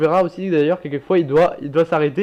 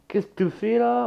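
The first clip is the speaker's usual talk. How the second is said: higher-pitched mock Québécois accent; Québécois imitation, pitched up; 6 semitones higher than usual. Shown as a demonstration. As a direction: put on a rough Québécois accent. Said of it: "au québécois ou en, au canadien?".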